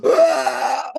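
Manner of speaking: sudden high yelp of fright; a startled man's high, loud yelp; startled cry. Retold or imitated. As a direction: jump out of your skin and shout ah. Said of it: "and he goes".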